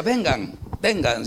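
usual speaking voice

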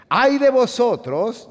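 high, slow, loud wail of "Ay de vosotros"; Philistines' hammed-up cry of dread; frightened wail. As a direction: cry it out in mock terror.

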